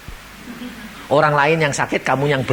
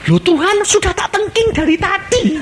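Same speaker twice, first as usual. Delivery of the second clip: exasperated complaint; 4 dB louder than usual. Retold or imitated. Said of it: "complains to God".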